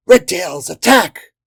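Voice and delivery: loud shout rising to a high yell; battle cry. A narrator's reading. Spear Thistle sending the Redtails in, battle cry. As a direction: launch the attack with a yell. Pilot orders an attack.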